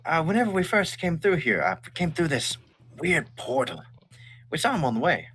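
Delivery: put-on high, surprised young man's voice; surprised butcher Aval. Butcher answers the stranger, high and surprised. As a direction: perk up, startled and chatty. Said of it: "He goes".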